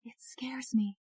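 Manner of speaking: frightened whisper. Acted. Ashley confiding to her lawyer in a whisper. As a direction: breathe it out, scared. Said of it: "Ashley whispered".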